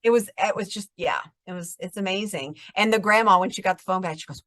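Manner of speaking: ordinary talk, nothing marked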